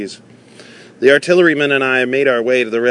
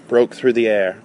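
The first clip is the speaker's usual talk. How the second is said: ordinary delivery, nothing marked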